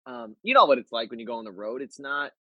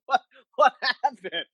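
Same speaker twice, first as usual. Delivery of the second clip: high, laughing bewilderment; puzzled and amused, pitched up; confused surprise; 12 semitones higher than usual. Retold or imitated. Guest retelling his confusion, high and laughing. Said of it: "I'm like".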